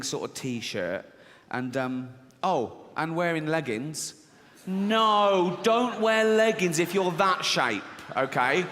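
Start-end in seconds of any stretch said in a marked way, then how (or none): 4.7-8.6 mock scolding shout. Real, not acted. Comedian scolding leggings wearer.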